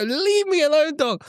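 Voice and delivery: high-pitched mock outburst; squealed comic desperation; exasperated yell. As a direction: beg the dog to back off, at wit's end. Yelling at the barking dog.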